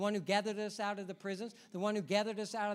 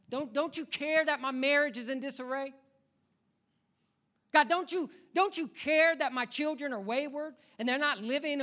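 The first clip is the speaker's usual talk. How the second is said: loud, higher anguished cry to God; desperate plea; 7 semitones higher, 7 dB louder than usual. Acted. Worshipper crying out to God about their troubles, desperate rising plea. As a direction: plead louder and louder, rising to a shout.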